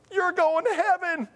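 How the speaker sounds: high-pitched joyful cry; retold jubilation. Answering the upset woman, high-pitched jubilation. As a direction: pitch it way up in mock elation.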